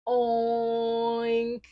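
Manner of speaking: long, level oink; pig oink. Acted. Teacher repeating the sound for friends, a long oink. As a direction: stretch out a pig's oink. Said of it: "goes the pig".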